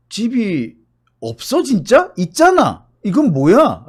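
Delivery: high, loud disbelief; can't believe what he heard; incredulous. Retold or imitated. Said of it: "라는 그 생각".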